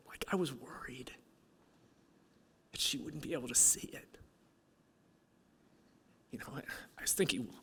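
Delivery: tearful, voice failing into a whisper; halting, whispery, fighting back real tears; choked up. Real, not acted. Speaker confessing a worry to the audience, choked-up whisper. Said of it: "sorry, I'm getting emotional".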